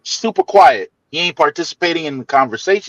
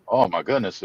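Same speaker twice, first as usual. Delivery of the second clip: normal talking voice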